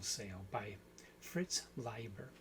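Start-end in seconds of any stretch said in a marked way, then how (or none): 1.2-2.3 Leiber said slowly and carefully as LYE-ber; slow, deliberate "Leiber" with a long I; careful pronunciation. Real, not acted. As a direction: take care over Leiber, first syllable rhymes with "eye". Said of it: "I have to say that carefully now".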